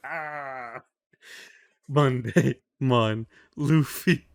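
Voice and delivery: hammed-up lovestruck moan of his name; lovesick pained cry. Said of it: "saying Luffy's name a little bit ... in pain".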